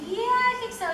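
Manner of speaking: high, hesitant agreement; drawn-up, tentative mock reply; unsure yes. Actress answering her director after a take, unsure yes.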